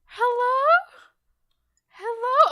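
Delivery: put-on high, eerie little girl calling hello; high creepy child repeating hello. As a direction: call out like a lost ghost child in the dark. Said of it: "creepy child, girl child voice".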